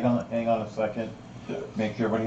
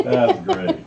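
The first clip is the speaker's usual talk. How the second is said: laughing; 4 dB louder than usual. Real, not acted. After big laugh.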